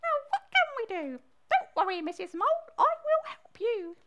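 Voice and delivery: high-pitched squeaky frog. Acted. Frog offers help to Missus Mole, high squeaky voice. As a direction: pipe it up as a helpful frog. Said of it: "said the frog".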